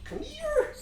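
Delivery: very high squeaky call and gasp; high-pitched delighted squeal. Real, not acted. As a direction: shriek at the puppy. Calling dog, then startled.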